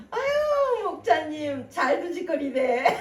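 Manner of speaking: put-on high, loud old granny greeting, laughing through it; mock the old lady's cheerful jab with a chuckle; hammed-up grandma calling out to the pastor; imitating a grandmother. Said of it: "얘는 누구 할머니인가 알죠?".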